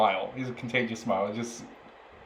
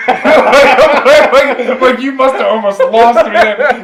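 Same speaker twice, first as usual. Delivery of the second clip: high and loud, laughing through the words; barely getting it out for laughing; real laughter; 13 semitones higher, 18 dB louder, slower than usual. Real, not acted. Laughing retelling doctor's visit.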